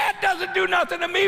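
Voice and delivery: very high, loud mocking shout; robber's sneer. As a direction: throw it back like the robber. Robber answering, retold.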